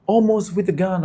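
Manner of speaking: high, loud excited yells; excited yelling. Real, not acted. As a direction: shout at the screen in excitement. Player juked in game.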